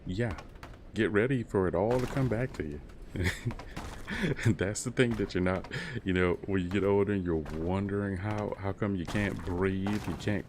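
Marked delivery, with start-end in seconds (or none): 2.8-4.4 high rising chuckle; knowing laugh. Real, not acted. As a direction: let out a real chuckle, rising in pitch. Laughs at consequences of ageing.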